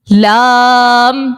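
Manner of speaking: لام with a very long, level vowel; لام held long for the students; articulated lam. Shown as a demonstration. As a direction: draw out لام slowly, closing on m. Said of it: "لام کا مخرج یہ ہے".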